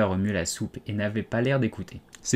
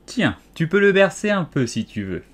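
higher, careless duchess voice; offhand, brisk; 6 semitones higher, 4 dB louder than usual. A narrator's reading. Duchess tossing Alice the child like a parcel, offhand. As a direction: toss it off briskly. Duchess brusquely hands over baby.

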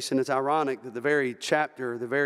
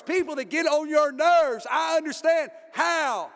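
full-force preaching; 8 semitones higher than usual. Acted. Preacher voicing the congregation's plea for words of life, full-force preaching. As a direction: drive it home to the congregation.

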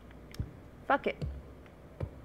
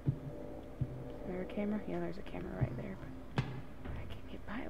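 hushed; 3 semitones lower, 9 dB quieter than usual. Real, not acted. Sneaking past camera.

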